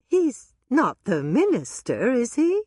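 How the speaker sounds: put-on high, loud, startled question from an older woman; Dolores, puzzled. A narrator's reading. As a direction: ask with rising alarm. Dolores voiced by narrator.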